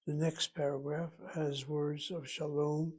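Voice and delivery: usual speaking voice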